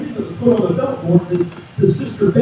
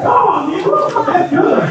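excited call-out; 12 semitones higher than usual. Real, not acted. Calling out to congregation.

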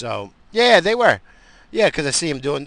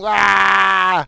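short loud high "Ah!" in a put-on voice; mock angry yell; 10 semitones higher, 13 dB louder than usual. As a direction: blurt out a fake furious cry. Outburst after self-mockery.

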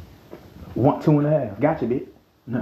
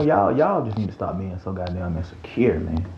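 usual speaking voice

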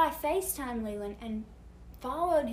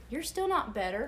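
ordinary talk, nothing marked

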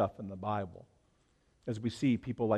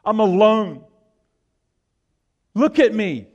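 anguished lament; 6 semitones higher, 10 dB louder than usual. Retold or imitated. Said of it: "In other words".

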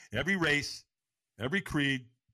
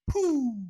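high, loud whooshing noise; whoosh of takeoff; 13 semitones higher, 7 dB louder than usual. Retold or imitated. Runner taking off over the coals, vocal whoosh. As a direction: imitate shooting off like a rocket.

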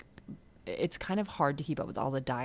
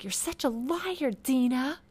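very high, drawn-out mock accusation; hammed-up outburst, louder on the name; snapping; 11 semitones higher, 5 dB louder, slower than usual. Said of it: "like, finally snaps".

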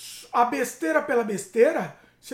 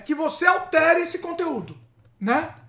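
ordinary delivery

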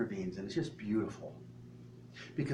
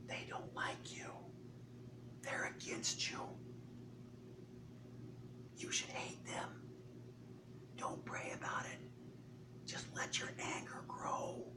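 devil's whisper; 17 dB quieter than usual. Acted. Satan sowing resentment against another person, sinister whisper. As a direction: whisper each poisonous thought separately into the listener's ear. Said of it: "does the devil whisper in your ear".

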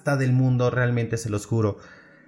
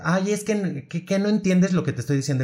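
mocking whiny boss; 7 semitones higher than usual. Boss Sofía whining at him.

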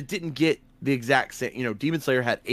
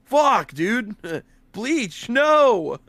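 excited outburst; 7 semitones higher, 5 dB louder, much slower than usual. Retold or imitated. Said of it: "And I'm like".

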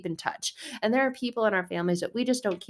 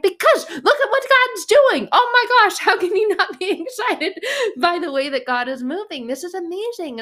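much higher and louder exclaiming; excited; 11 semitones higher, 9 dB louder than usual. Retold or imitated. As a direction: burst out with joy about God. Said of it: "I'm like".

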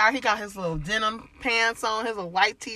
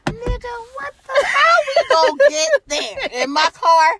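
high, loud disbelieving inner voice; high pitched mock outrage in your head; incredulous inner voice; 13 semitones higher, 6 dB louder than usual. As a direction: think it loudly in total disbelief. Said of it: "my inside of my head was like".

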